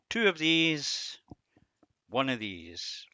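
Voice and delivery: Two slow and loud, then one; stressed counting. Shown as a demonstration. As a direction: count them off deliberately. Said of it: "listen twice as much as you speak".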